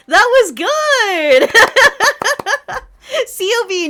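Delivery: ordinary talk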